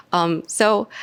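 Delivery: ordinary delivery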